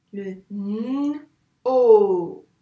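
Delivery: "n" hummed long, "o" held loudly; separated sounds. Shown as a demonstration. Teacher breaks the syllable into two sounds, sounded out. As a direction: split into a long "n" and a loud long "o". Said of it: "quel son on entend".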